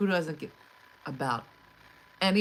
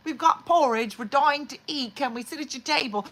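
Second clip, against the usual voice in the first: higher, louder begging orphan voice; pleading orphan; 10 semitones higher, 8 dB louder than usual. Retold or imitated. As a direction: ham it up like a pitiful little orphan. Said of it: "they're like orphans in".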